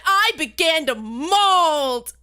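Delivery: very slow, drawn-out words; hammed-up slow seething; retold mad. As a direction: stretch every word with theatrical fury. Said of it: "I was so mad over the match".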